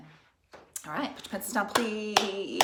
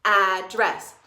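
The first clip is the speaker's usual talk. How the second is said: pounding the word; 8 dB louder than usual. Shown as a demonstration. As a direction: Address said loud and punched, syllable by syllable. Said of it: "Pound with me, please".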